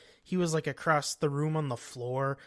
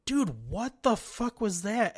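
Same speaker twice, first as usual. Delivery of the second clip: startled; 7 semitones higher than usual. Retold or imitated. Friends startled by screaming sleeper.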